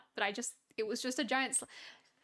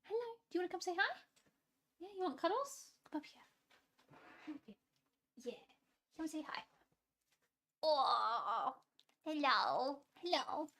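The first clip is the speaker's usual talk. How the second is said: higher, much softer coaxing voice; coaxing a pet; 4 semitones higher, 3 dB quieter than usual. Real, not acted. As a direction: coo softly to invite the pet up for cuddles. Coaxing her pet or child up.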